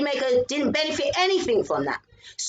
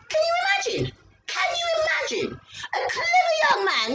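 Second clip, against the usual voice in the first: incredulous shout. Real, not acted. Retelling the classmate's ignorance, shrieking in disbelief. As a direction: cry out in disbelief.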